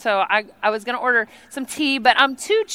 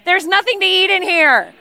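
put-on higher, louder kid's gripe; complaining kid; 8 semitones higher, 5 dB louder than usual. Kids open the refrigerator and complain to mom, whining mock gripe.

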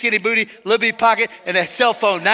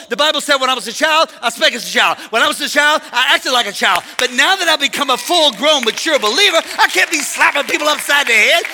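high, loud, driving sermon delivery; full-force preaching; 8 semitones higher, 7 dB louder than usual. Real, not acted. Preacher drives home lesson to congregation, full-force preaching. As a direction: preach it hard and rally the congregation.

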